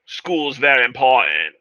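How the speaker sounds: slow, slurred imitation of Nicolas Cage's Southern drawl; lightly mocking a bad movie Southern accent. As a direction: mimic a clumsy Southern accent, slowly. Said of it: "there's one line where he's like".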